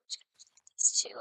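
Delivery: quick whisper to herself; whispered aside. Real, not acted. Streamer whispering to herself while planting flowers, hurried. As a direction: whisper it quickly under your breath.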